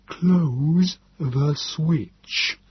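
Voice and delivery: very slow, high alien voice pressing; insist, drawn out and inhuman; urgent Nebogipfel. A narrator's reading. The Morlock urging the narrator to close the switch, very slow and alien. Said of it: "Nebogipfel said urgently".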